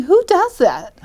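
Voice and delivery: high, loud disbelieving outburst; incredulous. Retold or imitated. To herself, seeing a man with liquor: high incredulous outburst. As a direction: voice jumps up in shock at the stranger. Incredulous thought about stranger.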